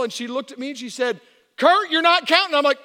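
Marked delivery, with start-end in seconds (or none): 1.6-2.5 loud, high imitation of the doctor; yelled scolding. Doctor scolding the silent husband, imitated yell. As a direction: call him out at full volume. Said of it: "You don't have to yell".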